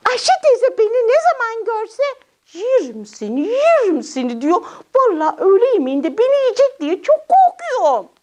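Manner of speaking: high little character voice, complaining; high puppet voice telling on an aunt; worried puppet child. Acted. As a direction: explain your fear as a small puppet. Puppet explains its fear.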